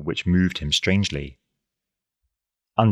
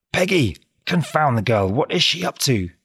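angry; 8 semitones higher than usual. A narrator's reading. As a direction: shout it out in irritation. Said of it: "he cried angrily".

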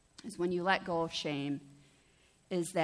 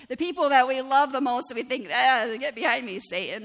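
loud, high exasperated cry at loved ones; exasperated outburst; 5 semitones higher, 10 dB louder than usual. Acted. Preacher voicing anger at loved ones, loud exasperated cry. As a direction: burst out as if fed up with family.

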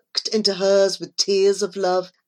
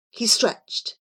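usual speaking voice

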